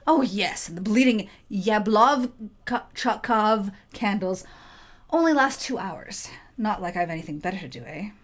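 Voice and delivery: thick Yorkshire artificer; grumbling northern voice; Yorkshire accent. Acted. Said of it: "in a thick Yorkshire accent".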